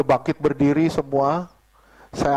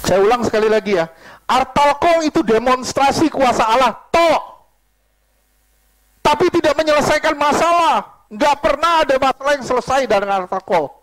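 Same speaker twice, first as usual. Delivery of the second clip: raised, forceful pulpit declaration; emphatic preaching; 8 semitones higher, 4 dB louder than usual. Real, not acted. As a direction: hammer the point into the congregation. (khotbah tegas dengan suara lantang)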